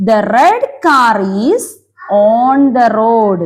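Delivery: very slow, word by word; slow reading aloud. Shown as a demonstration. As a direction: spell the sentence out slowly for kids. (പതുക്കെ വാക്കുവാക്കായി വായന)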